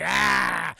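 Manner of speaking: drawn-out mock battle yell; imitated yell. Armand Assante lets loose, mock yell. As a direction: let out a long hammed-up cry. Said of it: "the fucking Armand Assante is like".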